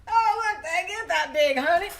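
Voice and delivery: high, loud teasing alarm; hammed-up fright; mock alarm. Mock alarm at giant catfish.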